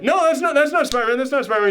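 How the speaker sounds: high-pitched, repeated mock denial; acting out a friend frantically covering; flustered denial. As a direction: blurt out a hasty cover-up, pitched well up. Ned acted hastily denying Spider-Man's identity.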